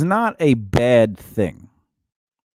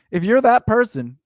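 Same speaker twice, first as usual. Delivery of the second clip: ordinary delivery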